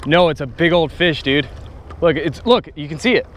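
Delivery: shouting while reeling hard; fighting a fish. Real, not acted.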